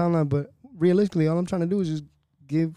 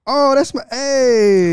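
surprised; 8 semitones higher, 9 dB louder, much slower than usual. Real, not acted. Caught off guard by name.

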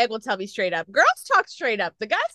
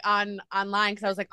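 ordinary talk

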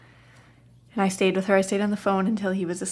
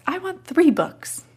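put-on child demanding; 9 semitones higher, 7 dB louder, slower than usual. Toddler demanding three books.

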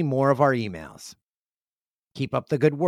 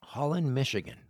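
wistful murmur; 3 semitones lower, 9 dB quieter than usual. Real, not acted. Repeats the town name, dreamily.

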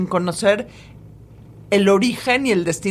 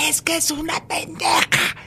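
soft whispered insult; gossip whisper; 5 semitones higher, 3 dB quieter than usual. Shown as a demonstration. Turning to a friend to trash someone, whispering. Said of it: "te volteas con tu amiga y le dices".

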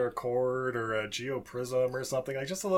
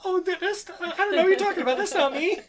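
high, rushed, laughing denial; nervous denial. Retold or imitated. Rabbit caught by federal agents, a fast high nervous denial. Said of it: "they're like".

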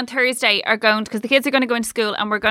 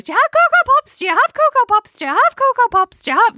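put-on high, insistent child voice; Hannah; 13 semitones higher, 3 dB louder than usual. Child asking at hotel breakfast, high insistent mock kid voice. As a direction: pester in a loud, squeaky kid's pitch, repeating. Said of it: "Hannah's just like".

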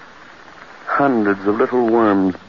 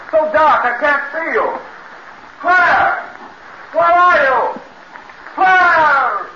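frantic calls, much higher and louder; desperate shouting; 3 dB louder, much slower than usual. Acted. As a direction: yell her name into the dark night. Searching for Claire in darkness.

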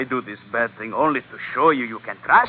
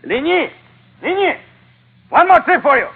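loud call across the deck; high, loud hail to a crewman; 8 dB louder than usual. Acted. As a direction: shout a name to someone far off. Calling out to a man on deck.

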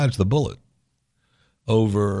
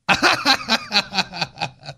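short high laugh; scoffing laugh; 10 semitones higher than usual. Real, not acted. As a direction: laugh at the idea. Laughs at lawsuit.

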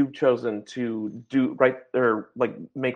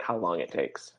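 weary artist; 9 semitones lower, 6 dB quieter than usual. Retold or imitated. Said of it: "the artist is like".

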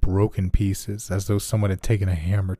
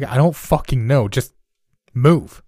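louder, higher angry snap; snapping; 6 semitones higher, 7 dB louder than usual. Acted. John snapping at Greg to move, angry. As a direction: bark the order at him.

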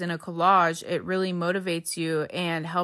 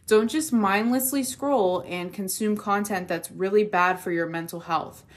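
ordinary talk, nothing marked

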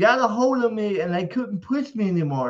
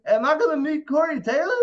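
put-on drunk asking; 6 semitones higher than usual. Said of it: "My drunk ass kept asking".

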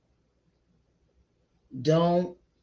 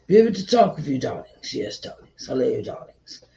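breathy vintage purr; 2 semitones lower, 4 dB louder than usual. Acted. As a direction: purr it like an old movie star. Sultry aside to the audience.

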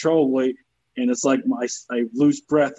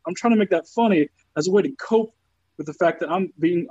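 ordinary delivery

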